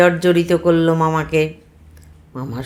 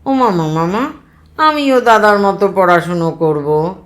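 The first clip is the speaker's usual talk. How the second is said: put-on insisting child; 3 semitones higher, 6 dB louder than usual. Child demands to study too.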